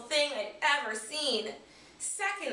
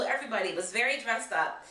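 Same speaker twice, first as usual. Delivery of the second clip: usual speaking voice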